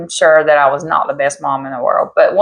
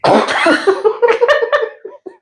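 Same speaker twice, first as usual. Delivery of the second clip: big high laugh. Real, not acted. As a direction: crack up high and long. Laughing at mama's pregnancy story.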